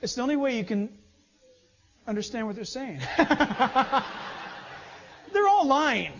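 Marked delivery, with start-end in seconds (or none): 3.0-4.8 a real, open laugh bursting out. Real, not acted. Pastor laughing at lying news anchors, real laughter. 5.3-6.1 higher and louder, laughing through the words. Real, not acted. Pastor mocking lying news anchors, laughing while speaking.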